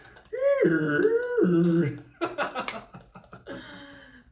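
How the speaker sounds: laughing till near tears; real loud helpless laugh; loud laughing fit. Real, not acted.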